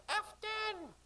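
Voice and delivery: put-on high sing-song child voices; sing-song children. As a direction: mimic the children singsong. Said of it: "sing-song voices of the children".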